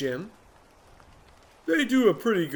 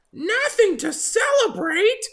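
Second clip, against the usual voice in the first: very high, slow, louder disbelief; shocked bird; 6 dB louder than usual. Acted. Oxpecker shocked at Jim, disbelieving. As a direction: gasp it in disbelief.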